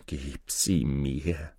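low, slow, soft plea; begging. A narrator's reading. Sorcerer begs Aladin for the lamp, low slow pleading. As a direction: beg with drawn-out words. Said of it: "bettelte der Maurer".

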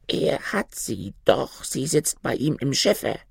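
a raven insisting in a breathy hush; third raven. Acted.

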